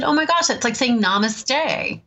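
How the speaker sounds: bright excited recognition; light up with a happy realization; delighted exclamation. Real, not acted. Telling the ex-prisoner his greeting is like namaste, delighted.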